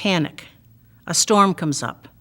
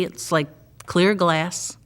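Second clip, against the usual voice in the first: ordinary talk, nothing marked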